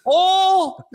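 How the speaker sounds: high, insistent country "oil" said as "awl"; long high-pitched country "awl" for "oil"; country pronunciation. Retold or imitated. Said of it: "pointing and saying".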